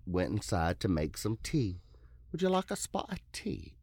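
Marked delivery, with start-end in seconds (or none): 2.3-3.7 high, put-on British tea-time voice; British accent. Acts mother making tea, British accent.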